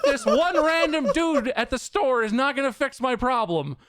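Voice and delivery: high, drawn-out, half-laughing outburst; exasperated. Real, not acted. Reliving near phone-throwing exasperation.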